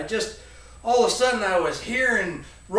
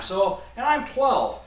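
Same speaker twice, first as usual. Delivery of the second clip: usual speaking voice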